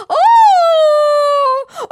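hammed-up royal shriek; screaming queen. A narrator's reading. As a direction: shriek out in panic at the top of the voice. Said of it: "орала королева".

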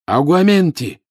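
high, loud incantation; shouted spell. A narrator's reading. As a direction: cry the spell out urgently. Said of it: "крикнул он".

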